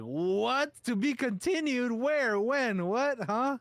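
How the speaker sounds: very high, baffled string of questions; incredulous shock. Retold or imitated. Reader blindsided by a cliffhanger, incredulous. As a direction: demand answers in comic disbelief, pitch way up.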